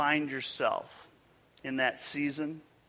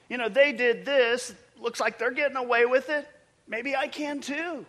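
excuse-maker voice; 5 semitones higher, 4 dB louder than usual. Retold or imitated. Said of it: "It goes something like this".

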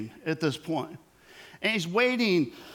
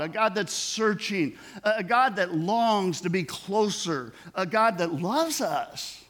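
raised, building sermon cadence; preaching build; 4 semitones higher than usual. Acted. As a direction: preach it, each God line landing. Sermon climax.

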